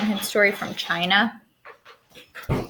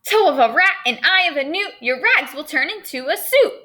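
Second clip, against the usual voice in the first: high, loud chanted rhyme; fairy's rhyming spell; 5 semitones higher, 5 dB louder than usual. Acted. Teacher voices the fairy's second spell, high and loud. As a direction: cast it like a witch's incantation.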